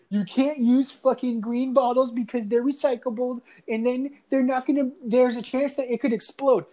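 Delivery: imitated objecting teacher. Teacher warning pupils about bottle rockets, mock objecting.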